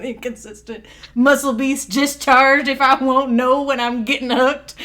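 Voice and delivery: slow, drawling, hammed-up Southern accent. Shown as a demonstration. Showing friends how the line sounds in their head, Southern accent. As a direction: read it in a big, loud Southern drawl. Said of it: "with a Southern accent in my mind".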